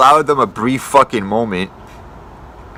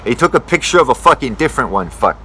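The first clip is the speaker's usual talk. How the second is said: normal talking voice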